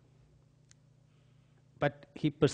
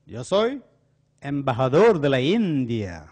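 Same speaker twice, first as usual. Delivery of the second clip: proud claim; 9 dB louder than usual. Retold or imitated. Crook boasts he is ambassador.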